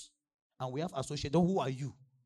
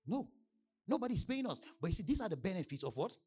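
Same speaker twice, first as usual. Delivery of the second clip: usual speaking voice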